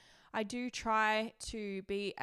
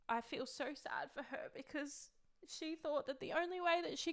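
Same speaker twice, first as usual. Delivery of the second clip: holding back tears for her past self; fighting tears; 5 semitones higher than usual. Real, not acted. Telling viewers about her younger self, near tears.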